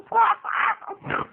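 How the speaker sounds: snorting pig laugh. Retold or imitated. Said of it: "laughing like a pig".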